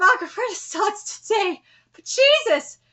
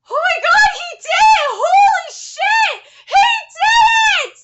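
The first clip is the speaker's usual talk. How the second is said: much higher, louder shrieking; hype scream; 10 semitones higher, 6 dB louder than usual. Real, not acted. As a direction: scream like the climax just hit. Screaming at final attack.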